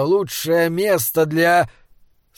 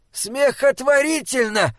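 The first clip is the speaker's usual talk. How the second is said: high-pitched, curt dismissal; troll's sharp, high retort; cutting retort; 9 semitones higher than usual. A narrator's reading. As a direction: cut him off from up high. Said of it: "отрезал Бинобик".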